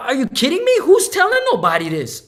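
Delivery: loud, high incredulous outburst; disbelief. Retold or imitated. Telling a friend the tax trick, loud disbelief. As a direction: shout it like you just can't believe it.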